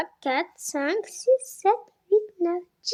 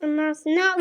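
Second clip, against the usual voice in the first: ordinary delivery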